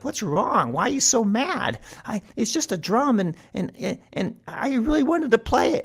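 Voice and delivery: very high squeaky stammering; nervous mouse. A narrator's reading. Cornered Mouse excuses himself to Cat, high nervous stammer. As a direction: plead innocence in a tiny high voice.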